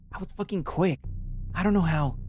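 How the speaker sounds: furious, confused. A narrator's reading. Tarki defends herself angrily to Aya after being caught. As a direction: snap back, baffled and furious. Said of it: "The badger just growled, furious and confused".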